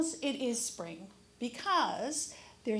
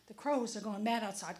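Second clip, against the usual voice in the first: ordinary delivery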